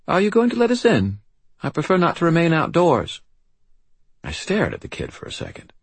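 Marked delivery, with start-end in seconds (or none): 0.1-3.2 put-on very high, serious British child voice; formal little girl with a faint British accent. A narrator's reading. Child asks the wizard to let her in, serious British accent. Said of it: "serious and marked with a faint British accent".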